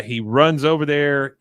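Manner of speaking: ordinary talk, nothing marked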